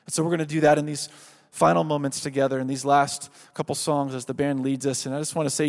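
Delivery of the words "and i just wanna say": normal talking voice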